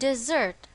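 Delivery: "dessert" with the stress on its second syllable, di-ZERT. Shown as a demonstration. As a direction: lean on the end of "dessert", di-ZERT. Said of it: "the stress is on the second syllable".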